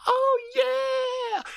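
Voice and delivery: ecstatic falsetto rocker cry; high-pitched wail. Retold or imitated. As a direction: imitate a headbanger's long high scream. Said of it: "I heard a high pitch".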